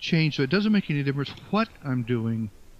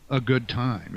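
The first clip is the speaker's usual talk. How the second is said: ordinary delivery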